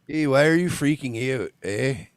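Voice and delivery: lightly exaggerated Canadian accent; mock Canadian accent. Host mimics man in clip, mock Canadian accent. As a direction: play up a Canadian accent, teasing. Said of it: "you never hear that accent thicker".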